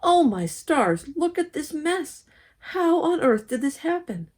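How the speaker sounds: high, dismayed scolding; an exasperated mom taking in the mess; indignant mother. Acted. Mad mother sees the mess.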